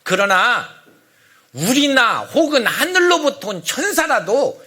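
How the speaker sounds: loud, emphatic warning settling into a grave close; stern, forceful apostolic decree; resolute. Acted. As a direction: pronounce the curse with total firmness. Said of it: "아주 그들에게 단호하게 얘기해요".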